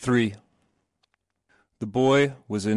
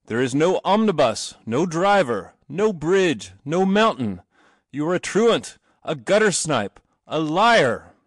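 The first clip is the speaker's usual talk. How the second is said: louder, higher stern accusation; stern father; 6 semitones higher, 5 dB louder than usual. A narrator's reading. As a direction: hammer each charge with the cane. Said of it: "saying at each stroke".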